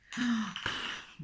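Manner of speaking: sharp, high intake of breath; startled gasp. Real, not acted. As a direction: gasp at a sudden discovery. Suddenly spots a forgotten thing.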